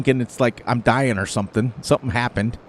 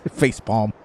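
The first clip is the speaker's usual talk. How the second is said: ordinary delivery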